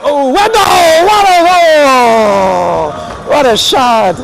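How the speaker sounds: drawn-out, high, shouted goal call; long goal yell. Real, not acted. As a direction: belt out the goal, stretch every word. Goal scored, announcer celebrates.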